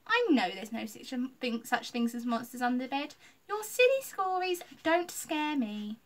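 put-on loud, boastful little girl; dismissive. A narrator's reading. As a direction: scoff like a know-it-all kid. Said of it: "Ella said with a dismissive wave".

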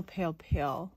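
usual speaking voice

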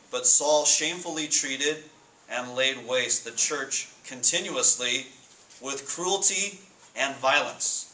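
intense scripture reading. Acted. Pastor reading of Saul's cruelty, slow and rising in force. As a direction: let the violence of the verse swell as you read.

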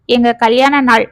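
ordinary delivery

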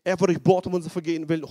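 schnell und lauter, eifrig vor sich hin gesprochen; nachgespieltes Proklamieren. Retold or imitated. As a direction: sprich den Vers laut im Zimmer vor dich hin. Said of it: "Ich bin in meinem Zimmer und lese". (eifrig nachgespieltes Bekennen)

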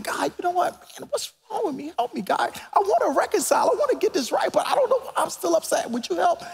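high, tearful voice cracking as he prays; weeping prayer. Retold or imitated. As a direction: plead with God through tears. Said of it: "I just started to weep".

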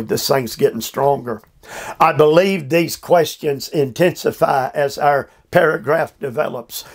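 usual speaking voice

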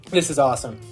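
usual speaking voice

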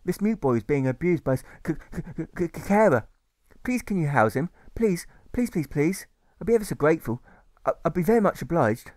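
high, stuttering request for help; a polite boy begging a matron; stammering plea. A narrator's reading. Alfred stammers as he asks the matron to house the boy.

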